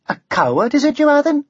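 high Welsh taunt; taunting Welsh dragon. A narrator's reading. Dragon calls the knight a coward, Welsh lilt.

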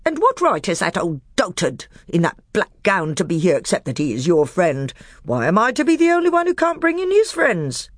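high, loud, heaping insults; scornful dwarf. A narrator's reading. Nickabrick mocking the magician in open anger. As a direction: jab each name at him.

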